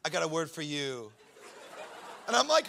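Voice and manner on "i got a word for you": low, ominous put-on voice; mock-dramatic prophet. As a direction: intone it deep, like a solemn mystic. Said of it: "It's just being dramatic for dramatic".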